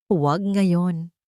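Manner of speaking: ordinary delivery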